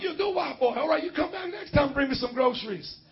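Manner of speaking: high, loud bossy send-off; bossy resident. Retold or imitated. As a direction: dismiss him like a servant, loud and high. Resident dismissing pastor.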